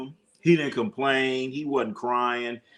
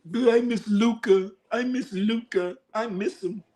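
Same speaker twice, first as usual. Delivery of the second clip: put-on high, whiny kid voice, pleading; mock crying boy; 8 semitones higher than usual. Mocking the boy apologizing to the team owner, whiny. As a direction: mimic a blubbering teen apologizing. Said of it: "He's crying to the owner".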